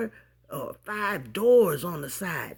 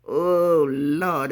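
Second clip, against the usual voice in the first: usual speaking voice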